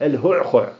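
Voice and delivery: الهعخع forced out from deep in the throat, every letter guttural; heavy throat word. Shown as a demonstration. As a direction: grind الهعخع through the throat. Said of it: "الله يسترنا الكلمة هذه".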